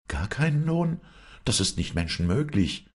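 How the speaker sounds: high, incredulous exclamation; high-voiced woman, utterly amazed; astonished wife. A narrator's reading. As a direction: cry out in disbelief, pitch leaping up. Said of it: "rief ganz erstaunt die Gutsherrin".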